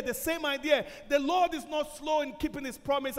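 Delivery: loud, urgent sermon delivery; full-force preaching. Acted. Preacher pleading with the congregation, loud and urgent. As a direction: keep pressing the message home.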